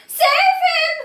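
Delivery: very high, loud scream. Acted. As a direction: scream for help in panic. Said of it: "screamed Missus Gloop".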